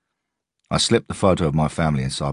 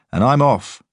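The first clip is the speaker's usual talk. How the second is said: radio report; 10 semitones higher, 6 dB louder than usual. A narrator's reading. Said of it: "Tango One said".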